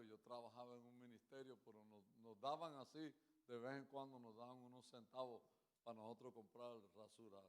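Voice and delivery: ordinary talk, nothing marked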